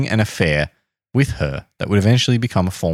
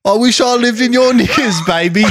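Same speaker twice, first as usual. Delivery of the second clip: posh Austin Powers voice; 14 semitones higher, 5 dB louder than usual. Retold or imitated. Quoting the king's tape.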